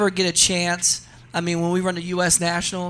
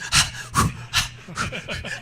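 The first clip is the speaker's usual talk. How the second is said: sharp gasps and puffs of breath; mimicking a racer gasping through held breath; 2 semitones higher than usual. Shown as a demonstration. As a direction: demonstrate tense, held breathing in bursts. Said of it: "they got the car like this".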